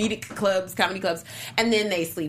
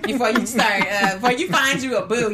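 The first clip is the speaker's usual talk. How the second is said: laughing; 3 semitones higher, 4 dB louder than usual. Real, not acted. Laughing advice to the woman.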